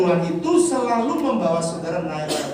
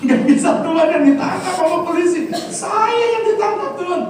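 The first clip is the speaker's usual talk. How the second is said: excited laughing outcry; 12 semitones higher than usual. Acted. Pastor joking to the congregation that he'd be arrested, laughing high outcry. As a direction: exclaim it laughing, climbing up in pitch.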